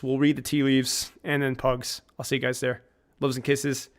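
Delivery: ordinary talk, nothing marked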